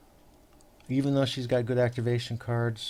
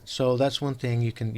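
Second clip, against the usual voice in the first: usual speaking voice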